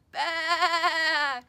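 big, loud, triumphant bleat; loud baa. Acted. Lamb finding his voice again, loud happy bleat. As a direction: let the baa ring out proudly. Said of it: "he let out a loud".

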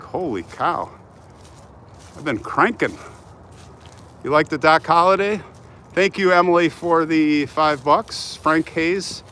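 ordinary talk, nothing marked

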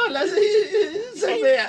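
squeaky, stretched greeting through laughter; mock her voice high while laughing; high laughing imitation. Husband imitating his wife's first phone call, laughing. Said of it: "imitating wife's phone greeting".

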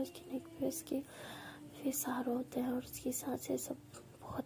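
soft, half-whispered romantic bedtime story; hushed storytelling. A narrator's reading. Narrating the lovers' night scene in a hushed voice.

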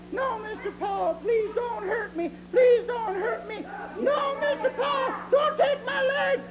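high, whimpering little-doll begging; pleading doll. Acted. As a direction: beg for mercy in a tiny squeaky voice. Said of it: "what I'm gonna do to you".

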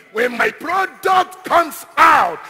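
loud, slow, high declaration; forceful preaching. Real, not acted. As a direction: build to a defiant proclamation. Defiant declaration in sermon.

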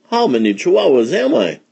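high, loud kitten voice; wondering out loud. A narrator's reading. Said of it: "he wondered out loud".

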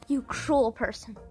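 normal talking voice

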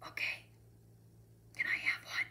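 hushed, secretive request; whisper. A narrator's reading. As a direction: whisper it so only one person hears. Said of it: "he whispered again".

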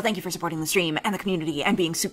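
fast, bright sub-alert thank-you; fast streamer thank-you read. Real, not acted. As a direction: rattle off a grateful shout-out. Reads subscriber thank-you.

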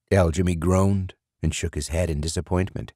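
ordinary delivery

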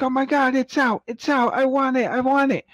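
very high, whiny mock fan; imitating whiny fan. Mocking New York fans crying for the toy, very high whiny voice. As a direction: whine like a desperate New York collector. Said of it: "running around crying like".

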